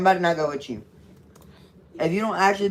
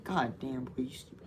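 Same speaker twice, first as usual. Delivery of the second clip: muttering; 7 semitones lower, 15 dB quieter than usual. Real, not acted. Older brother mutters insult.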